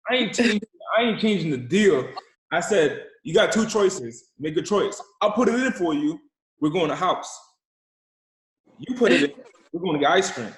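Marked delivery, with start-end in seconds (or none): 0.1-2.1 raised voice, higher and louder; firm refusal. Retold or imitated. Adult to bargaining kid, firm refusal. Acted standoff with the child. 3.2-10.5 loud, emphatic laying down of terms; firm ultimatum. Retold or imitated. Adult giving kid seatbelt choices, firm and loud.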